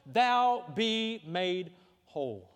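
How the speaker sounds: very slow, drawn-out voice of Jesus; a solemn, unhurried question from the Messiah; Jesus asking gently. Acted. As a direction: ask it slowly and solemnly as Jesus would. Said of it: "he looks at this man and he says".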